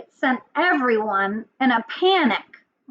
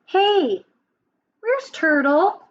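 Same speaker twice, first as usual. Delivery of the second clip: higher-pitched monkey calling for a friend; high, searching storybook voice; 5 semitones higher, slower than usual. Acted. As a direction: call out questioningly as the monkey. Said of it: "The monkey's looking for his friend".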